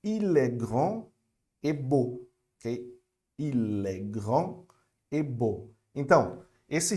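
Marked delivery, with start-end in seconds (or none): 0.0-2.3 il est grand said slowly, l joined to est; slow French demo. Shown as a demonstration. Teacher modelling French for students, slow. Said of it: "olha só". 3.4-5.7 Il est grand very slow, l flowing into est; very slow liaison demo. Shown as a demonstration. Teacher repeating the phrase slowly for students. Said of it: "eu junto o L com o E".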